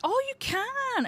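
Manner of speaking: high, slow, drawn-out surprise; delighted surprise. Real, not acted. Player finding she can use the newspaper, surprised and high. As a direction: marvel that it works.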